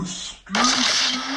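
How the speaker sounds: loud, drawn-out mock indignation; indignant outburst. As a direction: stretch it out loudly in acted outrage. Retelling an indignant reaction.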